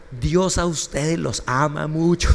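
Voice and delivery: slow, over-mouthed words for lip-readers; funny exaggerated talk. Pastor to deaf couple, slow exaggerated mouthing. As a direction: spell each word out slowly with the lips. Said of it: "como chistoso".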